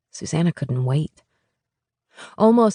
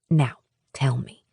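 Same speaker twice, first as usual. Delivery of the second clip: twinkling; 2 semitones lower than usual. A narrator's reading. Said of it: "with a twinkle in her eye".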